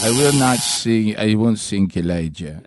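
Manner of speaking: French accent attempt. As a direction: refuse slowly in a deep French accent. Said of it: "get the French accent".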